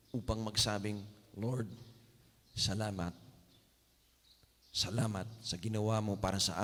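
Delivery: soft, low, fading prayer. Acted. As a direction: pray quietly to the Lord, winding down. Closing prayer. (mahinang panalangin)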